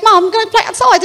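put-on little British boy, high voice; high-pitched British lad; Devin. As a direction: pipe up like a British kid asking mum. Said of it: "his name is Devin".

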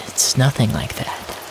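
usual speaking voice

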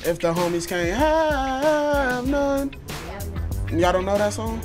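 ordinary talk

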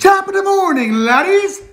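hammed-up Irish hello to the lads; Irish accent. Greeting in stage Irish phrase.

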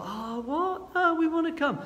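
high, pleading protest from the crowd; whining crowd. Pretend. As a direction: plead like disappointed followers. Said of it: "You can imagine people".

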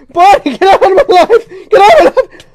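high, loud, crying scream; screaming shout. Real, not acted. Player screaming at the game as she falls, terrified. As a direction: shriek it as you fall.

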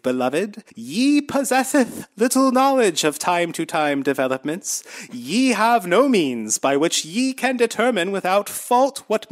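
high, slow, solemn biblical preaching; lofty old-timey Jesus voice, drawn out. Retold or imitated. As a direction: intone like a pompous prophet. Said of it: "this is what it sounds like".

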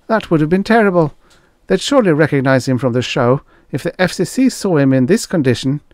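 high, fast worried chatter; nervous assistant. Acted. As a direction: rush it out anxiously. Frick voiced, nervous.

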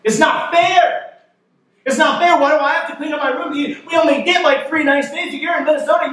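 put-on high, fast furious kid's rant; boy furious at his mom about cleaning his room, high angry rant; furious child. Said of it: "and he is furious".

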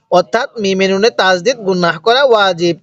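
usual speaking voice

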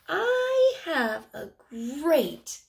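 slow, loud, stretched; dramatic read-aloud. Acted. Teacher reads the page to children with drama. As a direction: draw it out dramatically.

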